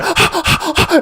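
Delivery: high, panicky gasping; imitated frantic gasps. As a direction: gasp in mock terror. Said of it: "And then he goes".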